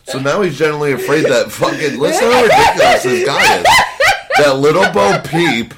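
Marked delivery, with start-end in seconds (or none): 2.0-5.7 loud, high, laughing disbelief; laughing incredulity. Real, not acted. Host ridicules the man's fear of Bo Peep, laughing incredulously. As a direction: shout it through laughter, ridiculing the threat.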